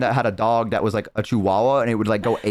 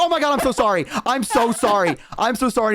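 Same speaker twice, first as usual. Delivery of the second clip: high, frantic apologising; panicked apology; 9 semitones higher than usual. Retold or imitated. Apologising to the startled mailman, retold high and flustered. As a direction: tumble out apologies in a raised voice.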